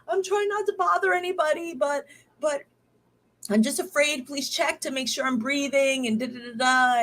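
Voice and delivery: to husband, a fearful high-pitched plea; retold fearful plea. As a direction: beg him to check on her breathing. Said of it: "I was just afraid".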